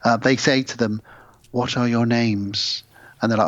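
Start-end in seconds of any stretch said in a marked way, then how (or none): 1.5-2.8 slow alien questioning. Retold or imitated. Alien couple asking questions.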